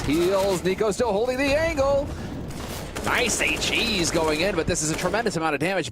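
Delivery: excited. Real, not acted. Caster builds hype.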